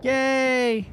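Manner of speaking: happy cheer. Real, not acted.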